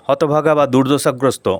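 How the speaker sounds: ordinary talk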